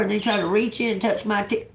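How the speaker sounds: ordinary talk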